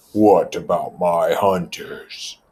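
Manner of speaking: slow, heavy insistence; Jack's demand. Acted. Jack challenging Ralph about his hunters, slow and pointed. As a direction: lean on every word.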